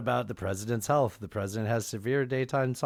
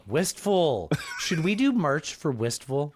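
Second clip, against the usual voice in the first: excited; 7 semitones higher, slower than usual. Real, not acted. Excited merch idea.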